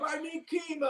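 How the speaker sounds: high shouted whiny plea; mock cancer patient. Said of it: "this fucking jackass comes up".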